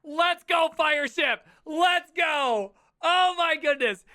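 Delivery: loud, high cheering shout; excited cheer. Real, not acted. Cheering Fireship as he praises developers, loud excited shout. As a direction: cheer the guy on at full volume.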